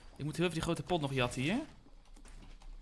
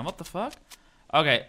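ordinary talk, nothing marked

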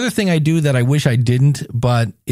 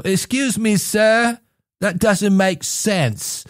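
mock polite objection; 6 semitones higher, slower than usual.

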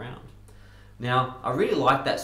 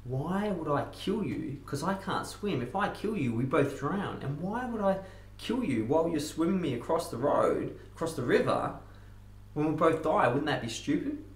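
high, swooping protest; mild mock yell; 7 semitones higher than usual. Scorpion argues with frog that both would drown, mild mock yell. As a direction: argue it out in a high, pleading pitch. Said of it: "He yells at the frog".

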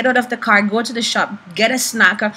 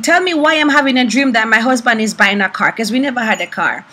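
ordinary talk, nothing marked

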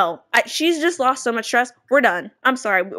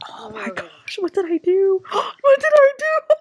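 mock crying; 10 semitones higher, 3 dB louder than usual. Voicing sim regretting breakup.

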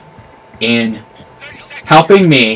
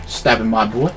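usual speaking voice

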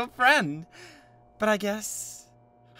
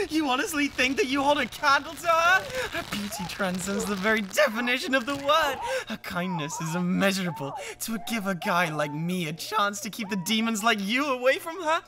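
sneering and raised, exalting her while belittling him; contemptuous rant; 7 semitones higher, 6 dB louder than usual. Acted. Rival sneers at the man who wants her, contemptuous rant. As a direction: lecture him with disdain.

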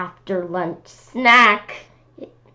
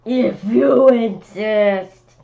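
elephant voice; 3 semitones higher, 7 dB louder, much slower than usual. Acted. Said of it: "said the elephant".

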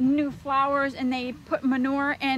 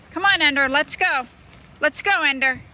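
loud call to come along; yelling at dog; 3 semitones higher, 8 dB louder than usual. Real, not acted. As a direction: hurry the dog along loudly. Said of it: "I don't mean to be yelling".

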